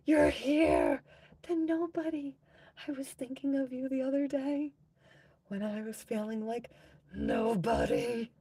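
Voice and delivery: high, loud excited greeting. Real, not acted. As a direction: squeal a happy hello.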